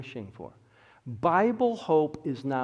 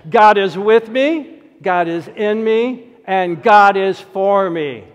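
louder, higher, slow declaration; really mean it; 7 semitones higher, 14 dB louder than usual. Shown as a demonstration. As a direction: proclaim each line with full conviction. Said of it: "say it like you really mean it".

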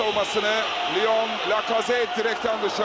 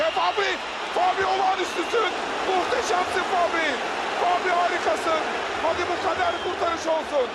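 excited commentator; 6 semitones higher than usual. Real, not acted. Praising keeper's save.

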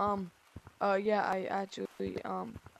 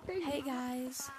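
soft put-on girly greeting; lightly faked girl voice; man doing a woman's voice; 3 semitones higher, 6 dB quieter than usual. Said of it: "I gotta get my girl voice ready".

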